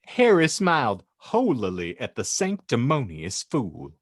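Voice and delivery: ordinary delivery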